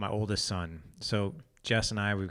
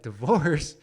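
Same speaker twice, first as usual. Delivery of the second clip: high, loud incredulous echo; mock disbelief; 7 semitones higher, 9 dB louder than usual. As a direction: repeat it as if it's absurd. Dad echoes son's divorce question.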